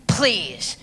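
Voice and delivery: breathy scoffing please under the breath; dismissive whisper. Real, not acted. Scoffing at the magic ring excuse, whispered. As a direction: brush it off in a whisper.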